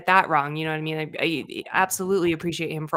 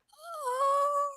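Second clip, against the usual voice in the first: high, soft startled oh; a starstruck little gasp of a word; husband frozen with surprise; stunned oh; 6 dB quieter than usual. Retold or imitated. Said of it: "he's just like".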